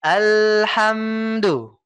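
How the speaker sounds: Alhamdu with the l flowing straight on; flowing sukun. Shown as a demonstration. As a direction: let Alhamdu run like water without a gap. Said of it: "biarkan dia mengalir seperti air".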